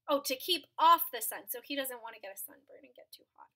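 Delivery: ordinary delivery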